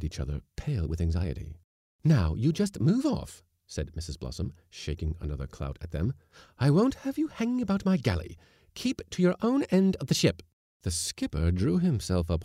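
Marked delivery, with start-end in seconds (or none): 2.0-3.4 high, loud, slow warning; threatening woman's high-pitched command; angry order. A narrator's reading. Cook orders the men away. 6.6-10.4 high, loud bossy scolding; domineering woman's loud orders; angry scolding. A narrator's reading. Cook drives men from galley.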